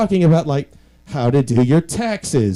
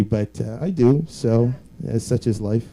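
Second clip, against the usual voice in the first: usual speaking voice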